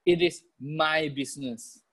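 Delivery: slow model phrase, "my" held long; deliberate pace, stretched "my"; stress on MY. Shown as a demonstration. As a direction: say it for repeating, weight on "my". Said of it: "Please say this".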